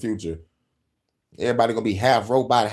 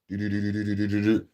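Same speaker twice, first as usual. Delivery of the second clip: vocal drum roll; 4 semitones lower, 5 dB quieter, faster than usual. Pretend. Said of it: "Drum roll, please".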